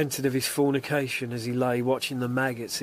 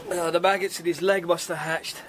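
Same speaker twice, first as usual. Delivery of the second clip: ordinary delivery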